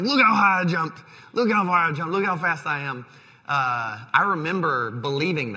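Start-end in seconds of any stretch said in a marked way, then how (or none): 0.0-3.1 imitating an excited kid, high and loud at first; high-pitched boast, easing down toward the end; excited child boast. Son showing off his new shoes to dad, excited.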